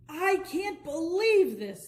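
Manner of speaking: high, loud, slow outburst; a hammed-up angry club owner; furious. A narrator's reading. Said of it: "He looked furious".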